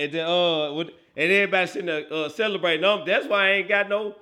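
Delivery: ordinary talk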